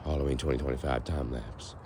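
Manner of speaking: usual speaking voice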